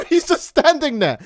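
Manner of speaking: ordinary talk, nothing marked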